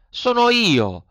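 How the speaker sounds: high, eager puppet. A narrator's reading. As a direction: Pinocchio answers the snail, high and eager. Pinocchio voiced. (burattino acuto)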